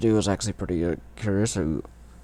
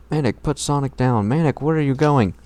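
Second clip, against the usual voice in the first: raised high shout; yelling; 7 semitones higher, 5 dB louder than usual. Acted. Sister yelling at her brother to put Sonic down, raised and high. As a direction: call after him as he runs.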